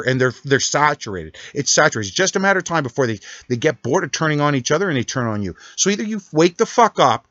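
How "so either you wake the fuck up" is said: raised angry demand. Real, not acted. Angry rant at President Trump.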